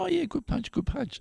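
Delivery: high, soft encouraging coach; gentle coach. Retold or imitated. Kind trainer praising a weak kick, high and soft. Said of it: "And he'd go".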